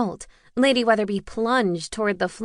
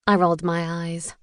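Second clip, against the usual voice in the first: usual speaking voice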